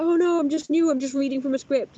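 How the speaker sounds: high flustered imitation; flustered caller. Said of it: "she's like".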